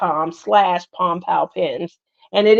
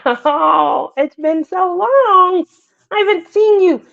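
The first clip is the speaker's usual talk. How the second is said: emotional greeting; 9 semitones higher than usual. Real, not acted.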